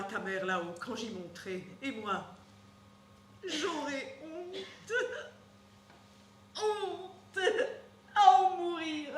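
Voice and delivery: beaucoup plus doux, j'aurai honte ralenti; honte brisée. Acted. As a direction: murmurer la honte, éteinte. Nourrice au bord des larmes. (la nourrice s'effondre de honte, très doucement)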